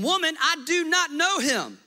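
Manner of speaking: high, loud panicked denial; scared Peter blurting his denial; Peter's denial. Acted. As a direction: shove the accusation away. Peter voiced denying.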